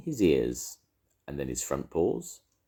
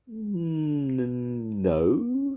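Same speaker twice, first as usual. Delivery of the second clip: high, drawn-out feigned nonchalance; careless; 11 semitones higher, 3 dB louder than usual. A narrator's reading. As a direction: stretch out a casual, unconvincing denial. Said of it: "said Pooh carelessly".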